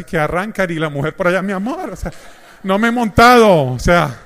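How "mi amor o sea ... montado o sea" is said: louder, higher mock-indignant wife; hammed-up offended wife calling after her husband; annoyed wife. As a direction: play the outraged wife left at the curb, laughing. Wife left behind by husband.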